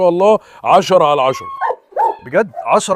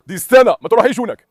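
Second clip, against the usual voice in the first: urgent warning; 6 semitones higher, 3 dB louder than usual. Real, not acted. Warning dog away.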